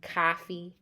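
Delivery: "coffee" as cah-fee; broad open ah in "coffee"; Boston-style "coffee"; Boston vowel. Shown as a demonstration. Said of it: "features obviously are the vowel changes".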